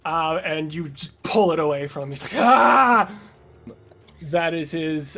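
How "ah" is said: high, loud cry of frustration; frustrated cry. Acted. Game master as a villain losing the briefcase, frustrated cry. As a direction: yell as it is pulled away from you.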